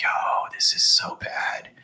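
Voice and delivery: slow, soft whisper to himself; inner whisper. Retold or imitated. Thinking in his head, a slow whisper. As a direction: breathe the panic under your breath.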